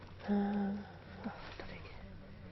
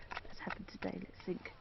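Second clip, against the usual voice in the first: whispering; 3 semitones lower, 15 dB quieter than usual. Real, not acted. Tired, freezing, talking to camera.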